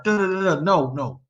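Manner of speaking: ordinary talk, nothing marked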